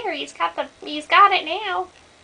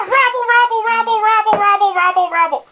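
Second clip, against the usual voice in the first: 1 high rabble chant; 5 semitones higher, 3 dB louder, faster than usual. Acted. Crowd murmur imitation.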